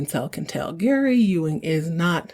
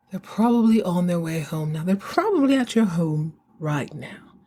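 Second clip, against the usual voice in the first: a hint of an old-money mid-Atlantic accent, imitating the mother. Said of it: "you gotta watch that mid-Atlantic accent".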